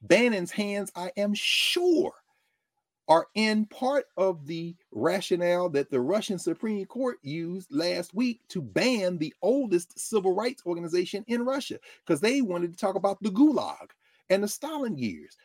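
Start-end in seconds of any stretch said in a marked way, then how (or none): none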